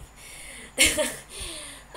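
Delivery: bitter little laugh; humorless laugh. A narrator's reading. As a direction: laugh without any joy. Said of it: "Sebastian laughed humorously".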